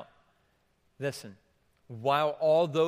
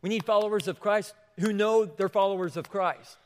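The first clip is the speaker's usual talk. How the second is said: loud and high, slowing on this is my name; fervent preaching; 6 semitones higher, 6 dB louder than usual. Real, not acted. Preacher's climax urging the congregation, loud. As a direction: proclaim it with conviction.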